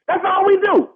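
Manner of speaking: angry host defending his weekend tailgate; heated protest. Real, not acted. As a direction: insist on it, worked up.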